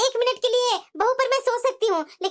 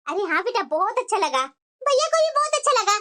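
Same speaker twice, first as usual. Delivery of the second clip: ordinary talk, nothing marked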